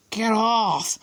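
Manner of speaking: high startled shout; Harry waking in the dark, shouting; loud. A narrator's reading. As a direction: shove them off, alarmed. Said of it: "he said loudly".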